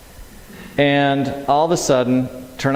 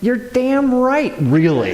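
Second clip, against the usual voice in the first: high, slow mock-indignant comeback; comic outrage, drawn out; indignant retort; 10 semitones higher, slower than usual. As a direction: snap back at the inner voice. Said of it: "And my response, honestly".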